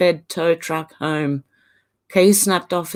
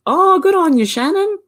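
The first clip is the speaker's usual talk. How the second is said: high, loud, delighted praise; excited cheer; 11 semitones higher, 4 dB louder than usual. Real, not acted. Cheering Shannon on for cutting her mullet, excited.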